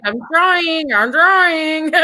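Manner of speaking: high, strained pleading; anxious pleading. Retold or imitated. Her reply while sedated.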